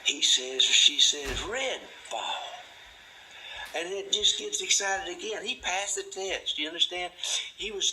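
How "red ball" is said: put-on higher, little child echoing red ball; child repeating. Child echoes the parent's red ball, higher child voice. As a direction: parrot it back like a toddler.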